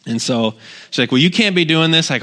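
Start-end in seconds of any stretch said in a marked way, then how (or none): 1.1-2.1 higher, louder mock objection; upset. Upset neighbor scolds pastor for weeding, mock upset. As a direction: imitate an indignant neighbor putting a stop to it. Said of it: "she was all upset".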